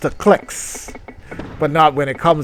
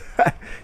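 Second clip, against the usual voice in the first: breathy laugh. Real, not acted. Laugh at story.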